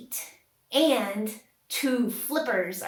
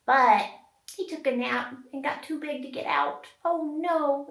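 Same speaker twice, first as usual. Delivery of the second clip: ordinary talk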